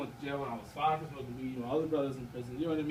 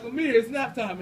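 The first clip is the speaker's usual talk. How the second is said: calling nap time; 5 semitones higher, 7 dB louder than usual. Retold or imitated. Uncle insisting the nephew nap.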